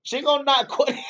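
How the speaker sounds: words breaking into a high laugh. Real, not acted. Cracking up over the knockout.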